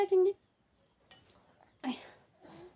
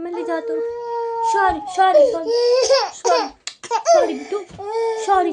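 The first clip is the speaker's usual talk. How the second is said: loud soothing; 9 semitones higher, 13 dB louder than usual. Real, not acted. Soothing crying baby over noise.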